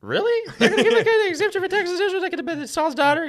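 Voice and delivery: shrieking amused disbelief at the offer; squeaky incredulous David. Retold or imitated. David hears about the king's reward, laughing disbelief. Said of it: "He's like".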